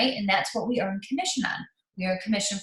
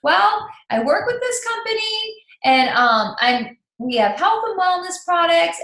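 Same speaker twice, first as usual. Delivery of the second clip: 1 mock bright pitch; 9 semitones higher, 5 dB louder than usual. Answering the friend on phone.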